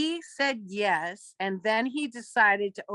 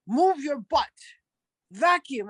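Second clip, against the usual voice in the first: raised, higher imitation of a bossy shop owner; bossy boss; 6 semitones higher, 4 dB louder, slower than usual. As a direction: order her about as the mock boss. Retold boss ordering her around.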